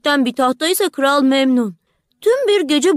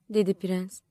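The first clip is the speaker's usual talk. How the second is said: ordinary delivery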